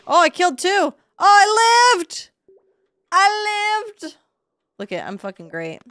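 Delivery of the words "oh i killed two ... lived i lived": elated relief. Real, not acted. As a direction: celebrate barely surviving. Surviving a fight in game.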